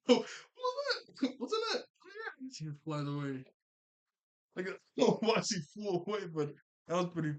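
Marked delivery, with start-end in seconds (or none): 0.1-1.8 very high, slow, silly mock voice; mock squeaky confusion. Recapping the funny cop scene, very high slow mock voice. Retelling cops' confusion, laughing. 2.0-2.5 mock squeaky reply. Cop answers, high soft.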